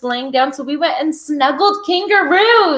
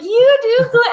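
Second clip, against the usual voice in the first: excited squeal; 11 semitones higher, 5 dB louder than usual. Retold or imitated. Teasing quote to star-struck fan.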